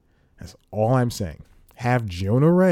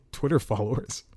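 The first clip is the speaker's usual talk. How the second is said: ordinary delivery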